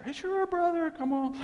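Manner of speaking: mild imitation of a cheerful preacher; imitating pastor. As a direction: toss off an easy yes like the pastor. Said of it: "like that".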